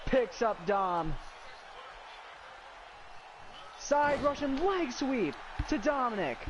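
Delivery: energetic wrestling commentator read; play-by-play commentary. Acted. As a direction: call the match live.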